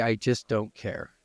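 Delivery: usual speaking voice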